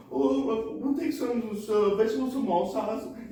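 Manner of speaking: high put-on voice; dad putting on an accent. Dad ordering while mimicking the waiter, high. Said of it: "my dad said".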